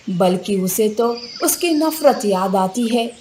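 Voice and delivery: plain reading voice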